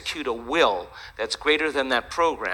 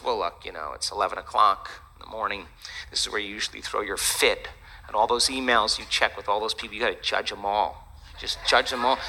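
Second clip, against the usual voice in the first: low, soft, reasoning voice; lightly voiced bargaining body; 6 semitones lower, 3 dB quieter than usual. Body talking the meditator into its usual routine, lightly voiced bargaining. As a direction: coax quietly in a lowered voice.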